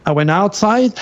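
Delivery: ordinary delivery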